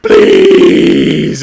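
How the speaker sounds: long, loud screeched plea; hammed-up anguished dragon wail; crying dragon. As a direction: scream it as if breathing fire. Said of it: "red from crying".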